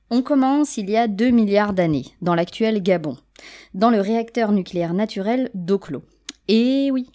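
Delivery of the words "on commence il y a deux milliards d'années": ordinary talk, nothing marked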